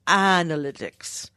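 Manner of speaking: slow, slightly British pronunciation; Mark Asquith British. Shown as a demonstration. Co-host suggesting a workaround, light British accent. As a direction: try a British accent on the word. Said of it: "like, Mark Asquith British".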